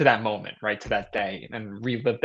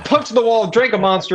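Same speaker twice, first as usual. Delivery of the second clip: hyped; 10 semitones higher, 7 dB louder than usual. Retold or imitated. Retelling getting hyped after pep talk.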